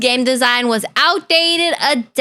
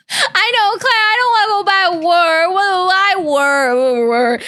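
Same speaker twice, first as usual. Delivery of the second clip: hammed-up crying, higher and drawn out; mock wailing; 4 semitones higher than usual. Streamer moaning to Claire about work, mock wailing. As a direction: whine and fake-sob, stretching the last words.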